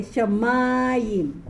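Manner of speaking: "Shamayim" slow, accented on the middle syllable; teacher modelling middle stress in "Shamayim"; penultimate stress. Shown as a demonstration. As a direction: draw out the "ma" in "Shamayim". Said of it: "So this is penultimate".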